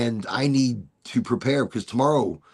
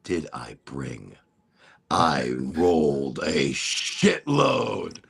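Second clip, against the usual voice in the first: slow stoner boast; 11 semitones lower, much slower than usual. Retold or imitated. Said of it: "I'm like".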